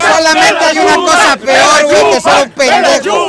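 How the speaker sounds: loud teasing yell; mock shouting. Real, not acted. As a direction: bellow it at your rival. Shouted insult at rival.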